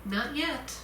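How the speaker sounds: softly soothing; gentle. Acted. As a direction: say it gently, kindly. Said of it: "gently said".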